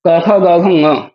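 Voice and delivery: क ख ग घ ङ spoken slowly, each letter apart; slow recitation. Shown as a demonstration. Teacher reciting the ka-series slowly for students. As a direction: spell out क ख ग घ ङ one by one. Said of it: "कु शब्द से कवर्ग आ जाता है".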